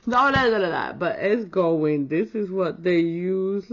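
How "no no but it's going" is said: ordinary delivery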